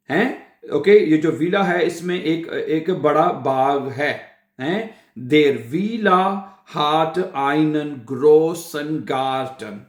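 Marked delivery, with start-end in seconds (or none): 5.3-9.7 German drawn out word by word; slow model sentence. Shown as a demonstration. Teacher repeats the villa example, very slowly. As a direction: stretch each word for learners.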